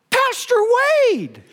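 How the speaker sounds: mock shout of his name from across the crowd, high on Pastor; retold shout. Protester calling out to the pastor, mock shout. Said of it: "I heard someone from within that group shout".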